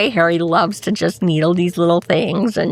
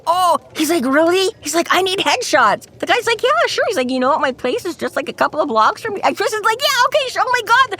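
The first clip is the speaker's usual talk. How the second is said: high excited quotes; 11 semitones higher than usual. Retold or imitated.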